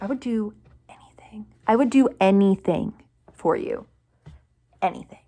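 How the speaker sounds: hushed, heartfelt promise; devoted whisper. Retold or imitated. Devoted fan answers [name].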